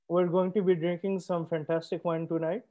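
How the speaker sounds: ordinary talk, nothing marked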